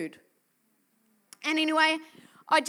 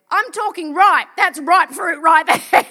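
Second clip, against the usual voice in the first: louder, slow, punching the word ripe; preaching at full force; 3 semitones higher, 14 dB louder than usual. Acted. Preacher to his congregation, loud and slow emphasis. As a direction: hammer home the ripe fruit.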